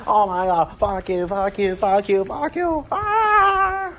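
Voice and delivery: imitating a ranting man-child, ending in a high mock scream; mocking his rant. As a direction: ham up a man-child's screaming fit, climbing to a squeal. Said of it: "he's like".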